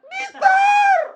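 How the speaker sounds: very high, loud, stretched-out yell of a name; comic full-volume shriek; imitated labour scream. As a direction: mimic a labouring wife screaming for her husband. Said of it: "Dia teriak-teriak".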